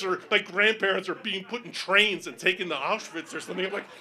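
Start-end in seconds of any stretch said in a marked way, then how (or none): none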